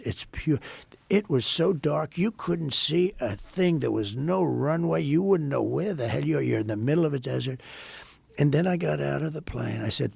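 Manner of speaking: usual speaking voice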